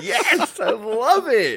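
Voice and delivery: very high, drawn-out squeal of delight; mock delight. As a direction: gush in a laughing falsetto. Acts out own delighted reaction.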